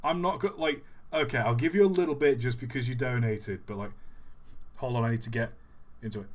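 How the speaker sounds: ordinary delivery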